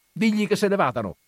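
loud, high, quick cry; urgent shout to send them off; shouted order. A narrator's reading. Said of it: "gridò mio zio".

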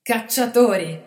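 loud, slow playful remark; joking. A narrator's reading. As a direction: shrug it off with a joke. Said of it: "commentò Planetta scherzoso".

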